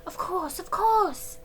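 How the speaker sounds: put-on high, girlish young dancer; lightly voiced young girl; eager high girlish reply. A narrator's reading.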